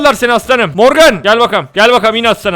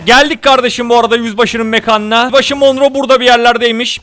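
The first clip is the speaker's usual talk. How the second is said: ordinary delivery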